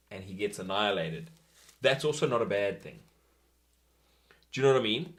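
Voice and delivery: ordinary talk, nothing marked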